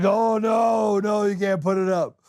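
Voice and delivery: high, hammed-up insistent protest; mock alarmed refusal. Host imitating reviewers vetoing the clip, high mock alarm.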